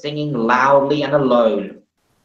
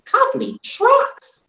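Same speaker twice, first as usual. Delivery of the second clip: squeaky voice; much slower than usual. Acted. Steamy's voice, drawn out.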